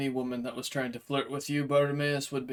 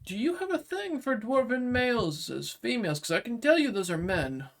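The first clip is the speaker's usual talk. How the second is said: high-pitched, disbelieving jab; incredulous Zyrex; 10 semitones higher than usual. Acted. Zyrex to Bartimaeus about dwarves, incredulous high voice. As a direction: question it in a high, put-on voice. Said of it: "Zyrex just looks at Bartimaeus and goes".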